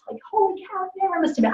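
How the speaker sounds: high, hushed disbelief; a surprised high exclamation, softly; amazed. Retold or imitated. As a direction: gasp it out like you can't believe it. Amazed at skipping a meal.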